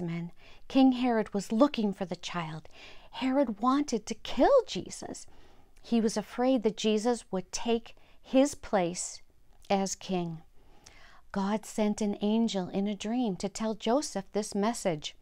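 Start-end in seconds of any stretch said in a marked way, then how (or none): none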